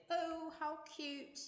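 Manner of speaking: mock posh British "oh, how cute", slow and high; a slow, high, hammed-up British talk-show voice; British accent. Host trying a British accent on a TV talk show. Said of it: "supposed to do British accents".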